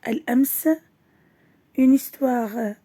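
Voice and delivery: usual speaking voice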